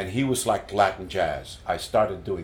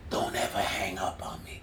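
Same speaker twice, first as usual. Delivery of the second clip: rasped, breathy near-whisper; 8 dB quieter than usual. Retold or imitated. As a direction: imitate a raspy voice on a late-night phone call. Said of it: "I hear this raspy voice".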